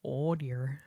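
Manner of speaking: fretting deer. Retold or imitated. Streamer quoting the freaked-out deer, mock fretting. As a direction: lightly imitate a deer in a fret. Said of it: "He was like".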